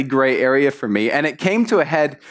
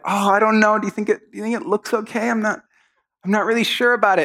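put-on unsure girl; 7 semitones higher than usual. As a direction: fret hesitantly, seeking reassurance. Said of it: "she's like".

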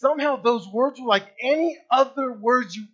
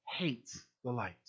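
hushed; 8 semitones lower, 14 dB quieter, slower than usual. Acted. Preacher drops voice on key phrase.

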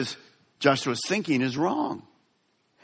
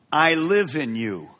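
ordinary talk, nothing marked

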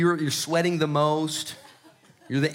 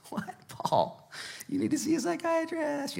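whispered "What, Paul?" then jumping much higher; mock skeptic; 13 semitones higher, 7 dB quieter than usual. A skeptic scoffing at Paul, first whispered, then much higher. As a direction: play a scoffing onlooker, high and incredulous. Mocking listener answering Paul.

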